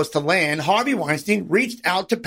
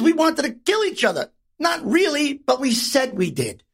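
ordinary talk

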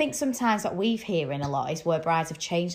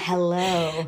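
sexy voice. Retold or imitated. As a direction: purr it seductively. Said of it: "Is that sexy voice?".

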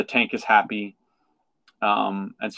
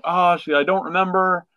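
higher, confused fish; lightly voiced Dory; higher forgetful voice; 6 semitones higher than usual.

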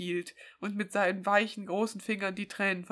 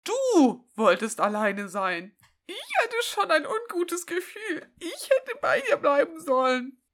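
mock crying; 8 semitones higher, 4 dB louder than usual.

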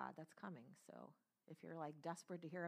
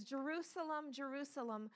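rising, pleading voice of a grieving Jesus; Jesus lamenting over Jerusalem, heartbroken and pleading; 5 semitones higher, 9 dB louder than usual. Acted. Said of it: "And he says".

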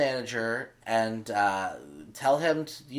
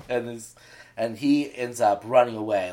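ordinary talk, nothing marked

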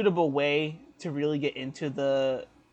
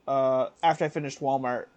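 usual speaking voice

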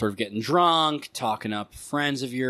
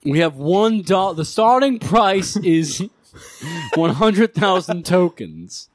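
loud auctioneer call; 6 semitones higher, 6 dB louder than usual. Acted. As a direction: call it out to the room. Auctioneer announces the price.

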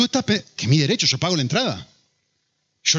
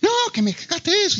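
high-pitched mock yell of an upset child; grito de hija. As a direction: imitate a little girl protesting, pitched way up. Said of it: "le grita y le dice". (grito agudo imitado de niña enfadada)

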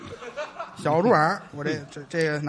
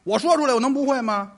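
mock-offended shout of pride; indignant boast; 7 semitones higher, 10 dB louder than usual.

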